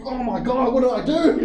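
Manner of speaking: high, loud flustered cry; mock panic. Panicked retelling.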